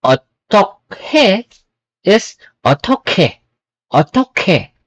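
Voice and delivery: otokhe with a breathy kh; otokhe, puff of air on the k; aspirated k. Shown as a demonstration. Teacher showing the aspirated K. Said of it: "Mas maraming hangin".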